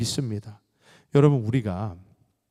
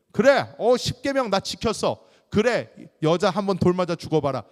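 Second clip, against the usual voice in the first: loud, high, self-righteous outburst; raised voice, sure you are in the right; self-righteous youths; 6 semitones higher, 5 dB louder than usual. Acted. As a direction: act out a cocksure young accuser. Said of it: "아주 의로워요".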